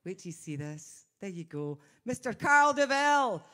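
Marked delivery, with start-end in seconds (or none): 2.1-3.4 loud, high showman announcement of the guest; announcer intro. Acted. Host bringing out Carl DeVille, loud showy announcement. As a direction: present him like a big star.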